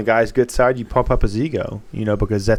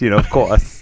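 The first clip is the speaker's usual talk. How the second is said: normal talking voice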